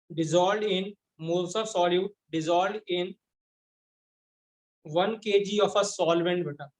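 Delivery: slow, spaced-out dictation voice. Shown as a demonstration. Teacher dictating the definition to the class, slow and spaced. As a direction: read it out slowly so students can write.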